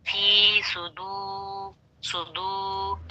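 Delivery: फी सुदू with फी stretched long and सु short; drawn-out recitation. Shown as a demonstration. Teacher slowly reciting फी सुदू so students hear the lengths. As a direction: stretch फी, clip सु, hold दू. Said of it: "फिर से गौर कीजिए".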